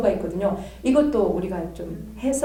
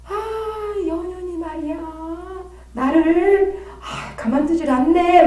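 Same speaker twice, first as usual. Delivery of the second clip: higher whining, crying spirit voice; spirit's voice; 8 semitones higher, slower than usual. Retold or imitated. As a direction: play a tormented demon complaining. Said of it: "막 이래요".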